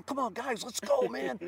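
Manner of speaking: high, loud dugout cheer; cheering teammates on. Retold or imitated. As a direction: yell encouragement from the bench. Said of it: "screaming at the top of my lungs".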